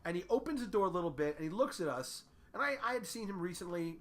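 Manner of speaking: ordinary talk, nothing marked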